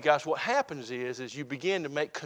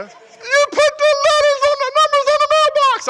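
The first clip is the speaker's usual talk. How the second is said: very high, loud, thrilled squeal; wife's ecstatic squeal; 12 dB louder than usual. Retold or imitated. Wife thanking husband for mailbox letters, ecstatic high squeal. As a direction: gush with delight, way up high. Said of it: "throw her arms around me".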